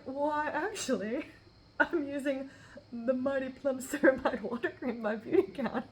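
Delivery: laughing through a proud answer, slow at the start. Real, not acted. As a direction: laugh as you name the cream with mock pride.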